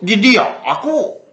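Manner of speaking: slow Barito medok "Jidia aku" with a rise on "aku"; slow rerun of the Barito medok; Barito medok accent. Shown as a demonstration. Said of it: "Nah, begitu".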